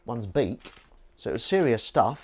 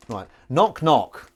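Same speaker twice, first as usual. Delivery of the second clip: slow knock knock; 2 semitones higher, 7 dB louder, much slower than usual. Shown as a demonstration. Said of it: "which I'll do to you now".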